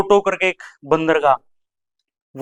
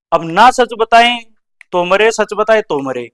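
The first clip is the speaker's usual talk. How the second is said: ordinary talk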